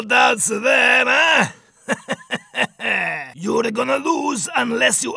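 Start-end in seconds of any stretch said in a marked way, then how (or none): 1.9-3.3 low staccato chuckle; throaty chuckle. Acted. Shopkeeper to racer, throaty chuckle. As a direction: chuckle knowingly.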